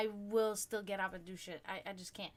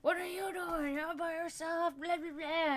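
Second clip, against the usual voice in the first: ape the rowdy biker taunting her, pitched up; mocking the bikers; 8 semitones higher than usual.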